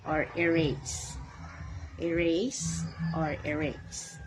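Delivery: erase drawn out slowly, each syllable clear; slow model of erase versus erase; slow careful pronunciation. Shown as a demonstration. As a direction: spell out erase slowly for the class. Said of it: "contrasting two pronunciations of erase".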